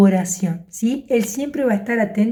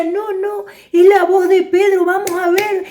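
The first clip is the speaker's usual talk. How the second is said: insistent; 7 semitones higher than usual. Acted. Sister insists it is Pedro.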